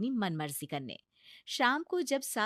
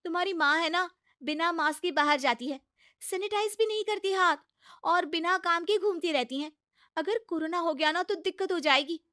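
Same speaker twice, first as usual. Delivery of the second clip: higher, coaxing bahu voice; pleading daughter-in-law; 8 semitones higher, 5 dB louder, faster than usual. Acted. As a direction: talk sense into a stubborn mother-in-law. Bahu complains to husband. (ऊँची, मनाती हुई बहू की आवाज़)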